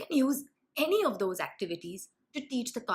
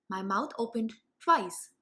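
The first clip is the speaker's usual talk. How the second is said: ordinary talk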